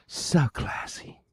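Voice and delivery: soft breathy whisper, slow. Real, not acted. Streamer mocking his own classiness in a whisper. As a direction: murmur it under your breath.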